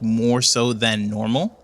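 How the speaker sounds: normal talking voice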